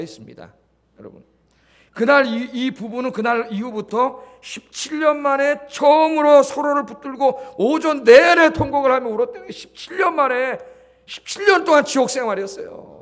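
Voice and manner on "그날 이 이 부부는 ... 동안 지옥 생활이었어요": rising, loud preacher's cry; preaching with full force; fervent preaching. Acted. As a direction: drive the story home to the congregation. Preaching the climax.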